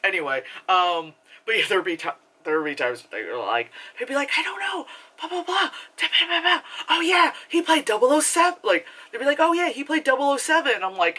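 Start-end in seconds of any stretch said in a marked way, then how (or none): 4.4-8.6 high-pitched imitation of chatter; chattering old ladies. Imitating ladies talking through the movie, high chatter. 9.4-10.8 lightly mocked high-pitched chatter; chattering old lady. Imitating a lady talking through the movie, high chatter. Repeats chattering lady's remark.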